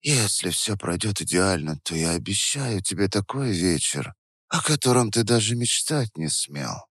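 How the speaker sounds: soft promising whisper; seductive whisper. Acted. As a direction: hush it, full of promise. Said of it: "продолжала Вера шёпотом".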